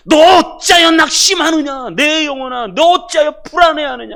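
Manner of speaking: high, shouted scolding; loud, impassioned telling-off; thundering rebuke. Acted. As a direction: roar at your own soul, demanding an answer. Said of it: "낙심 호통 치시기 바랍니다".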